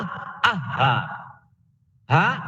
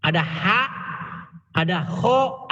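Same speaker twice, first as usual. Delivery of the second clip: usual speaking voice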